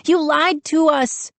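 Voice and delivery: put-on very high, outraged girl; high-pitched indignant scream; screamed accusation. A narrator's reading. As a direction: accuse them in a high cry. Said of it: "Mindy screamed at the two gnomes".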